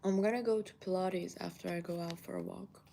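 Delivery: normal talking voice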